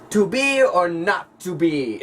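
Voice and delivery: slow, grand stage recitation, high and loud; mock theatrical Hamlet; hammed-up Shakespeare. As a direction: declaim it like a ham actor.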